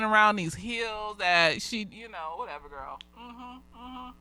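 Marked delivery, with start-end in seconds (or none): none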